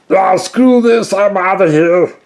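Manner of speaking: very high, loud, slow cartoon squawk; panicked bail-out. Kaylor running from the Efreet, a very high loud squawk. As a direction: squawk it out like a coward fleeing.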